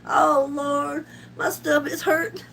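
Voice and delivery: ordinary delivery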